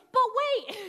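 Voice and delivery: sudden loud, high burst of excitement; really excited. Retold or imitated. Airport shop clerk suddenly remembers her cough drops, really excited. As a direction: interrupt with a loud, thrilled outburst. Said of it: "like, really excited".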